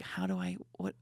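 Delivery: ordinary talk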